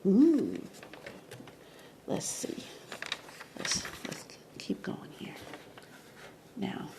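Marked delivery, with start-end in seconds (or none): 2.1-6.9 whisper. Real, not acted. Muttering to himself on camera.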